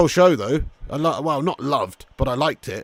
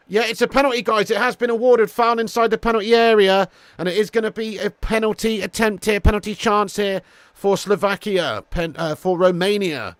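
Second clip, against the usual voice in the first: raised, projected play-by-play; live football commentary; 6 semitones higher, 6 dB louder than usual. Real, not acted. Commentator telling viewers a penalty is given, raised and excited. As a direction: announce it like a match commentator.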